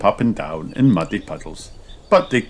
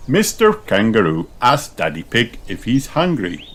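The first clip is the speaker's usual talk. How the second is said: ordinary delivery, nothing marked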